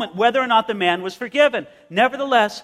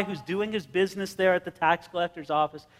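normal talking voice

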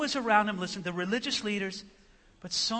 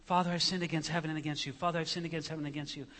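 fast, softer run-through of a speech; rehearsing under breath; 3 semitones lower, 5 dB quieter, much faster than usual. Acted. Son practising his confession to his father, quick rehearsal. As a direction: rattle it off quietly like practising lines. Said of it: "rehearsing the speech in his mind".

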